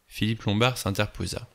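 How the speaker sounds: plain reading voice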